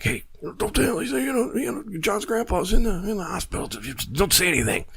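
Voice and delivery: put-on a scared kid begging the others to keep quiet; panicked friends. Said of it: "It's like".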